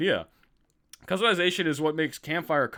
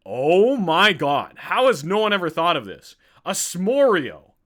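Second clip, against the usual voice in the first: loud, high, drawn-out amazement; big stretched-out excited outburst; blown away; 5 semitones higher, 7 dB louder, slower than usual. Real, not acted. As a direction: exclaim it like a huge food revelation. Amazed by a dessert idea.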